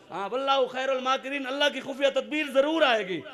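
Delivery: high, forceful sermon delivery; impassioned preaching. Real, not acted. Preacher rallying the faithful, impassioned preaching.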